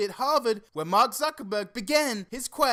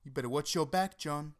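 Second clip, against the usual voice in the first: much lower and softer, a hammed-up threat; menacing hush; 7 semitones lower, 7 dB quieter than usual. Host quietly threatens viewer John, low mock menace. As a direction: drop into a sinister low murmur. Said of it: "Let's try not to threaten our viewers".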